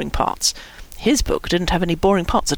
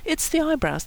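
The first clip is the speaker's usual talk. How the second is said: higher, soft insistence; lightly insistent, pitched up; explaining; 8 semitones higher, 5 dB quieter than usual. A narrator's reading. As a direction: repeat it as a helpful explanation. Brian repeats over Kevin's objection.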